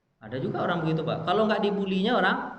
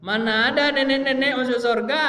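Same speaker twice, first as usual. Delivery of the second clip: higher, louder mock-stern jest; the Prophet joking; 9 semitones higher, 8 dB louder than usual. Prophet jokingly tells the old woman she won't enter heaven. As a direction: tease with a straight-faced boom. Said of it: "Nabi bilang apa?".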